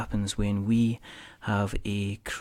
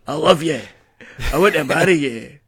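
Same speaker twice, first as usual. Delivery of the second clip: hammed-up Scottish Mel Gibson, higher and louder; a mock Scottish declaration of love, Mel Gibson style; Mel Gibson Scottish accent; 4 semitones higher, 9 dB louder than usual. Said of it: "best Scottish Mel Gibson good at accent".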